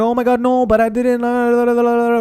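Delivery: high whiny mock voice of the ex; mock girl voice babbling excuses. Mocking ex's defensive whining.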